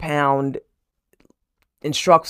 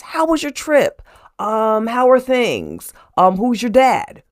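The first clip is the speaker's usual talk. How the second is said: employers asking questions; 7 semitones higher, 4 dB louder than usual. Retold or imitated. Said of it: "they're asking her questions".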